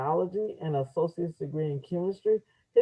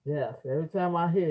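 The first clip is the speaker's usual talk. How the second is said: usual speaking voice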